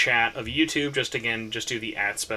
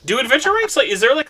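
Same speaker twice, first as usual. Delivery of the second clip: excited question; 11 semitones higher, 11 dB louder, faster than usual. Real, not acted. Eager question about rank cap.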